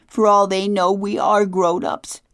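put-on high, loud tearful cry of a girl; a hurt girl sobbing it out loud; sobbing outburst. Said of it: "Claudia sobbed".

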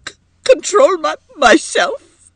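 high, loud, stammering sobs; brokenly. A narrator's reading. Edith throws his advice back at him, brokenly. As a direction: choke on the words in outraged tears. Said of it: "exclaimed Edith brokenly".

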